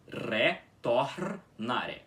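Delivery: "Retornare" very slow, rolled R after the O; slow pronunciation demo. Shown as a demonstration. Teacher modelling the word slowly again. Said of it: "Você tem que puxar o R do começo".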